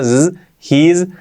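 "he's" ending in a held z; buzzed zzz closing "he's"; buzzing final S. Shown as a demonstration. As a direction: draw out the z at the end of "he's". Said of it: "con la S un poco seguimos así".

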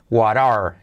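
"what are" linked as "wa-rar"; T as soft R. Shown as a demonstration. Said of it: "T como R suave". ("what are" con la T como R suave)

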